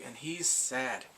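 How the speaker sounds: ordinary talk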